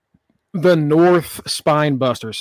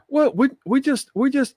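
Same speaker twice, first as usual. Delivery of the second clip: high, stammering mock excuse; flustered excuse; 10 semitones higher than usual. Imitating scolded students to Chase, high flustered stammer. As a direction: plead in a raised pitch, tripping over the words.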